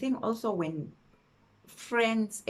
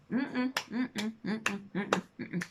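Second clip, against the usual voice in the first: disapproving hums; 3 semitones higher, slower than usual. Real, not acted. Refusing emphatically.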